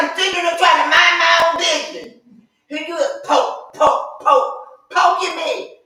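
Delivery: irritated retort. Retold or imitated. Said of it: "I said".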